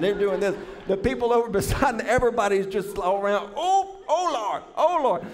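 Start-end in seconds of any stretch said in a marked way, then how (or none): 3.5-5.3 high-pitched wailing to the Lord; hammed-up cries of disgust and dismay; mock horror. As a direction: cry out high, as if overcome. Acts out horrified passengers' reaction.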